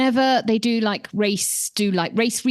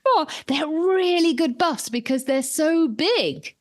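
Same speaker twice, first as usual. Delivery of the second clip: gushing; 7 semitones higher, slower than usual. Retold or imitated. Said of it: "because I was like".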